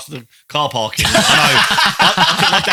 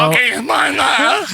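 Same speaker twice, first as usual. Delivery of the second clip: high whiny voice; 12 semitones higher, 4 dB louder, slower than usual. Pretend. Chris voiced in mock scene.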